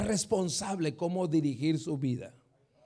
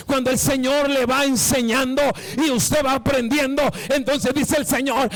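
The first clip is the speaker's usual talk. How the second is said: fiery preaching; 4 semitones higher than usual. Acted. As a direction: preach it out, climbing with each line. Pastor preaching at full force.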